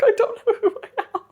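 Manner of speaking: very high, hurried wail; mock distress. Retelling what she told her date mid-panic, mock distress. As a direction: squeak it out as a hammed-up meltdown.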